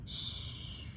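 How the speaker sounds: soft breathy shh of wind; whispered shh. A narrator's reading. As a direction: let it out like wind in leaves. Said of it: "it whispers".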